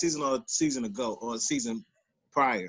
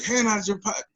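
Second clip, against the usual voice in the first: high-pitched imitation of a command; retold cop order; 8 semitones higher than usual. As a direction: mimic an agitated officer ordering. Retold cop's order at gunpoint.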